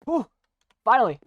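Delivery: high, loud shout of relief; real triumphant yell; relieved triumph. Real, not acted. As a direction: shout it out after endless tries. Success after many fails.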